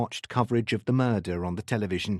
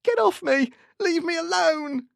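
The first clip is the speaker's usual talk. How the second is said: panicked, very high yell; high-pitched screaming; 3 dB louder than usual. A narrator's reading. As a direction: scream as you are wrestled down. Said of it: "high-pitched screaming filled the room".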